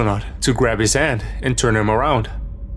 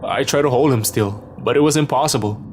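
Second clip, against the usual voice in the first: usual speaking voice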